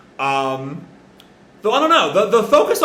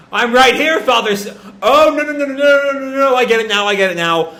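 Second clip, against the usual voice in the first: louder, higher frantic repeats; manic realization; 7 semitones higher, 6 dB louder than usual. Acted. Possessed Reiji calling to his father, frantic and loud. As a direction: spiral into it, building to understanding.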